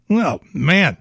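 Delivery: usual speaking voice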